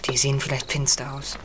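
low hushed murmur. Acted. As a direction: keep it down, they're close. Hiding, watching the men.

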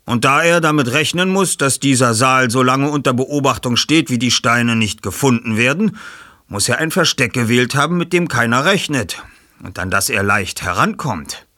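Bob, sachlich erklärend. Acted.

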